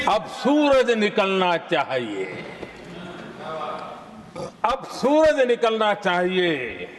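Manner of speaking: slow, declaimed verse with long pauses; poetry recitation. Acted. Recites a poem in a speech. (ओजपूर्ण कविता पाठ)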